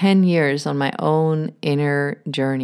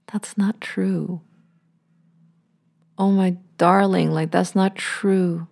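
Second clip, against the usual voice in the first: tender comfort. Retold or imitated. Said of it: "you would tell it".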